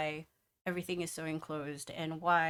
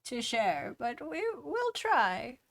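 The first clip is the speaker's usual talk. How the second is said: put-on old dwarf voice; 7 semitones higher than usual. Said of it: "the old dwarf".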